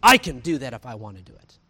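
loud boastful assertion, mimicking a stubborn believer; mock chest-thumping. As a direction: hit the opening hard, chest puffed out. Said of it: "thumping their chest".

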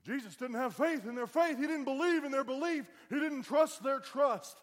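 high, full-force hollered preaching; old-school preacher shout. Shown as a demonstration. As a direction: holler each line like an old-time preacher. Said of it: "I can be old school if I need to be".

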